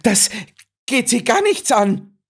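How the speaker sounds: high, sharp shriek. A narrator's reading. Miss Claxton shrieks at Holmes, very high. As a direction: shriek at him to back off. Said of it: "kreischte sie".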